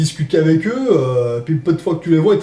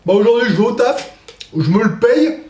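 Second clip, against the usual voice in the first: usual speaking voice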